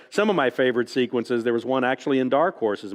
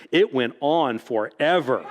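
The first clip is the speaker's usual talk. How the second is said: slow line with laughter breaking in; slow it down, laughing through it; slower than usual. Retold or imitated. Author quoting the fan while laughing, slowed down. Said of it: "And she goes".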